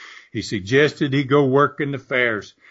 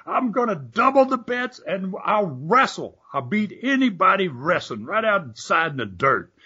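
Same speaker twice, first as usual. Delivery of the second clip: higher, changed-voice brag; put-on blowhard voice; 7 semitones higher than usual. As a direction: play the idiot throwing money away. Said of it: "he goes".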